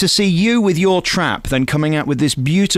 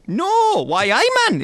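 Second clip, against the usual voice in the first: high-pitched comic Geordie; exaggerated Geordie exclamation; Tyneside accent; much slower than usual. Shown as a demonstration. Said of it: "if we were up in Tyneside, what would happen there?".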